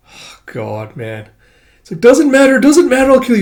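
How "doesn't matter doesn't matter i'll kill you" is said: put-on high, loud child threat; menacing threat. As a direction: hiss it out as a threat.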